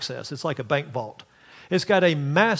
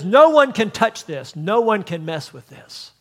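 proclaiming; 8 dB louder than usual. Retold or imitated. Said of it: "and says".

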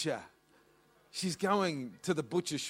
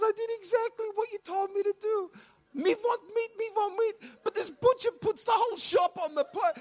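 much higher, crying, rising to a sobbing shout; bawling complaint; 6 dB louder than usual. Retold or imitated. Mum crying to dad about the butcher, high and sobbing. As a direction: wail it out through tears. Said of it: "Mum is bawling her eyes out".

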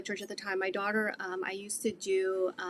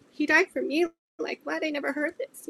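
high, incredulous question on "He died for me?"; astonished disbelief; 6 semitones higher than usual. Retold or imitated. Retelling her first hearing of the gospel, astonished. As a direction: repeat the news in amazement, pitched well up.

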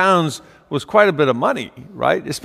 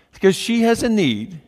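normal talking voice

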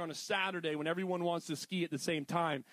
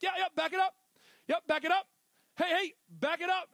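higher, louder call to a reversing driver; 9 semitones higher, 5 dB louder, faster than usual. Retold or imitated. Parking attendant calls to a driver in a car, higher and louder. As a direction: wave them back with a raised voice. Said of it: "be like".

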